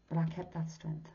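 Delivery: normal talking voice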